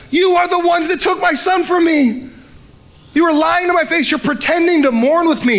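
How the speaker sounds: a betrayed father shouting at his lying sons; angry father. Retold or imitated. Father imagined accusing sons.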